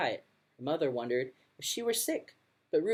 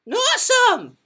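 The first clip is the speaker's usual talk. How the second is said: high, very loud "Awesome!"; excited shout; 12 dB louder than usual. Acted. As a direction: burst out with "Awesome!" full volume. Loud Ruby cheering delightedly.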